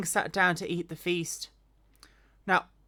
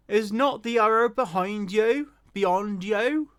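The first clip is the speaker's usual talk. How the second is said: louder call across the field; 3 semitones higher, 4 dB louder, slower than usual. Acted. Jonathan calling after the lad in the field, loud. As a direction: call after the running boy. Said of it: "Jonathan cried out after the lad".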